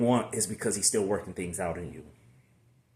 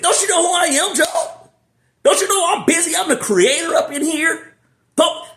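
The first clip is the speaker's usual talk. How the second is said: high, loud, comic scolding shout; hammed-up angry God; God scolding Job; 10 dB louder than usual. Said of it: "This is what God said".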